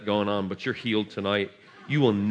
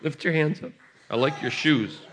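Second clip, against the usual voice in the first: ordinary talk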